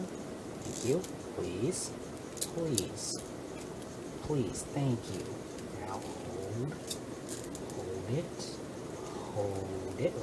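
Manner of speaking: soft low careful murmuring; hushed coaxing. Real, not acted. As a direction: coax it gently, barely voiced. Hushed coaxing while placing.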